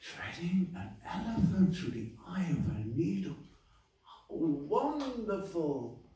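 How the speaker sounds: gasp, then high, drawn-out wonder; awestruck cobbler. Acted. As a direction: marvel at it with full faith. Cobbler marvels at the same news.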